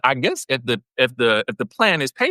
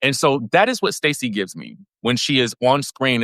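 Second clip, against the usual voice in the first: ordinary talk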